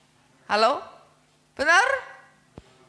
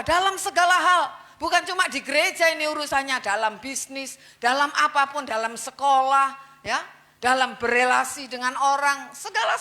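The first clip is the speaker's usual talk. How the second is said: fiery preaching; 3 semitones higher, 4 dB louder than usual. Acted. Preacher urging the church to be holy, loud fiery sermon. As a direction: preach it to the congregation with fire.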